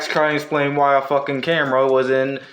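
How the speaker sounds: normal talking voice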